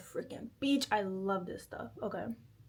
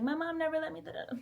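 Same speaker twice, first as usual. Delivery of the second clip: mocking whiny girl; 6 semitones higher, much faster than usual. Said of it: "one of those girls that's like".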